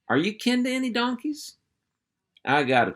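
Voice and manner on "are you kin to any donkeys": a puppy asking what a rude word means; innocent little girl. Acted. Barbara innocently asking her uncle, high curious girl voice.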